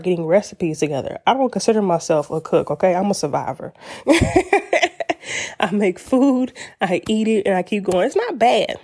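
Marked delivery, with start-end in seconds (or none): 3.9-5.6 high laugh. Real, not acted. Laughs at being "a survivor.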